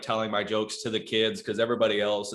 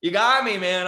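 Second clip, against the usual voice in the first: mock concession; 10 semitones higher, 7 dB louder than usual. Comic concedes to the kid.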